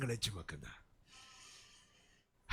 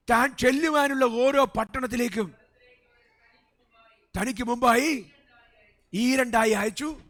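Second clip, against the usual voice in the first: louder, slow, emphatic reading of the verse; preaching scripture; 4 semitones higher, 5 dB louder, slower than usual. Real, not acted. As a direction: proclaim the verse from the pulpit. (ഉച്ചത്തിൽ വചനം വായിക്കുന്ന പ്രസംഗശൈലി)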